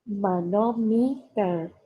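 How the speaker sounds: "Manometer" slowly, each syllable separate; divided for learners. Shown as a demonstration. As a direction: spell out "Manometer" by sound, ma-no-mi-ter. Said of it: "la voy a ir dividiendo". ("Manometer" despacio, sílaba por sílaba)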